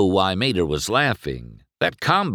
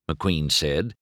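usual speaking voice

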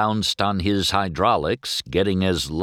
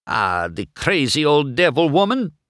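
grouchy; 8 semitones higher, 3 dB louder than usual. A narrator's reading. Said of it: "Luigi said grouchily".

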